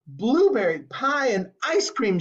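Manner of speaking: high, thrilled announcement; excited shout. A narrator's reading. Violet thrilled as the dessert flavor arrives, high. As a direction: proclaim the dessert with delight. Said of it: "shouted Violet".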